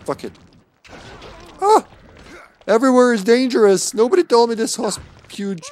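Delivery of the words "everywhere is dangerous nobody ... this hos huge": loud, high, hammed-up alarm; mock panic. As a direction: panic about the danger theatrically. Panicked by monsters in hospital.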